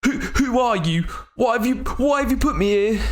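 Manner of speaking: panicked captive asking who they are; frightened stranger. Acted. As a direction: demand answers, scared and cornered.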